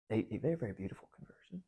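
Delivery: a quick, hushed whisper of awe. Real, not acted. Teacher whispering to the class how beautiful the conversion is, hushed. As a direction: breathe it out like a secret.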